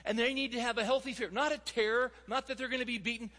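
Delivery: preacher pressing hard on fathers; passionate preaching. Acted. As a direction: drive the point home to the congregation. Preaching at full force.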